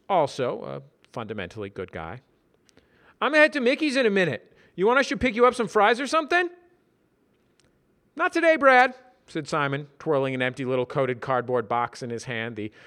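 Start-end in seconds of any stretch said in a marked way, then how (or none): 3.2-6.5 high, fast, loud chatty voice; Brad voice. Acted. 8.2-8.9 high, loud cheerful refusal; Simon voice. Acted.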